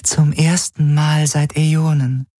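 high, level dream voice; Helix in a dream. A narrator's reading. Helix speaking to Galen in a dream, high and level. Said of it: "hatte sie im Traum gesagt".

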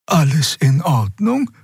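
slow, high creature voice; old Draxyl asking. A narrator's reading. Old Draxyl Xea asking Endriel if all is well. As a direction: ask slowly, concerned. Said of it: "fragte Xea".

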